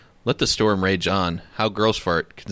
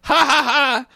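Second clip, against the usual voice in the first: mocking laugh; 13 semitones higher, 4 dB louder, slower than usual. Friends mocking in script.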